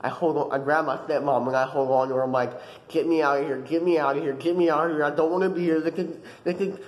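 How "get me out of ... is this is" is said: frantic pleading, climbing in pitch; acted meltdown, rising to a yelp; panicked breakdown. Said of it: "I have a mental breakdown".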